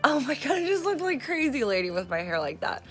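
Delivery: normal talking voice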